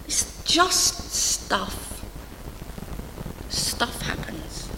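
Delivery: dropped to a near whisper; hushed. Real, not acted. Preacher hushing the church for the punchline. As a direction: let the words fall quietly.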